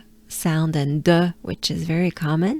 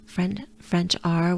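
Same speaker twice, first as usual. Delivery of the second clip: ordinary delivery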